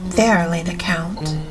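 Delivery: usual speaking voice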